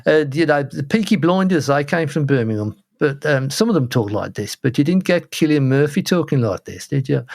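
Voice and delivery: put-on Brummie accent; Birmingham accent. Shown as a demonstration. Podcaster showing his co-host how Birmingham people talk, Birmingham accent. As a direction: talk like a Brummie out of Peaky Blinders. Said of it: "This is kind of a Birmingham accent".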